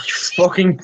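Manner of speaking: usual speaking voice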